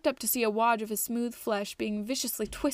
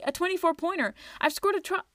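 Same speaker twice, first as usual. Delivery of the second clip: much higher, gleeful, cut off mid-word; excited outburst; 8 semitones higher than usual. A narrator's reading. Twig celebrating before he sees the glares, high. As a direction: crow with glee then break off. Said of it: "looked around happily".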